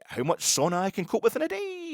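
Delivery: high, hammed-up mock Welsh title; lightly mocked Welsh accent. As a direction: announce it like the Welsh lad's video. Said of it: "I can't do the Welsh accent".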